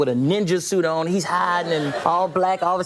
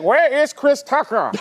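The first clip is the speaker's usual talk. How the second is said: slow, higher statesman impression; Nelson Mandela; 6 semitones higher, 3 dB louder, slower than usual. African leader asking after the comedian, slow grand voice. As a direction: draw out each word grandly. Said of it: "he was like".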